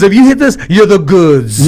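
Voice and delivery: imitated East Coast buddy. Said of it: "He goes".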